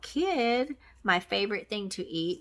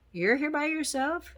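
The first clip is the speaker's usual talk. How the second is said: surprised customer; 8 semitones higher than usual. Retold or imitated. Customers surprised girl alone.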